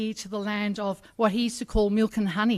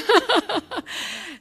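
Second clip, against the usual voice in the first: short, high burst of laughter; quick real laugh, pitched high; amused laugh; 12 semitones higher, 4 dB louder than usual. Real, not acted. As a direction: laugh at your own teasing joke. Laughs after token Irishman joke.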